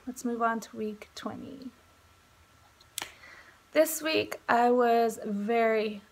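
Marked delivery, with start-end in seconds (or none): 0.1-1.7 whispered aside to the camera. Real, not acted. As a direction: drop to a breathy whisper for the transition. Aside to camera, new topic.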